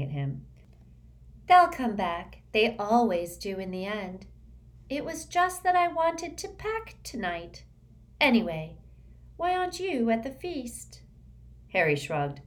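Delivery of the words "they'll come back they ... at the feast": airy, high and dreamy schoolgirl; dreamy girl voice. A narrator's reading. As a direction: float the words, lightly otherworldly.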